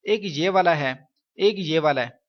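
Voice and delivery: ordinary delivery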